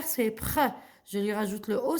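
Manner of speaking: ordinary delivery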